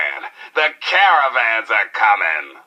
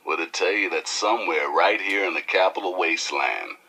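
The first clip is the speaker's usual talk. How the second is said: ordinary talk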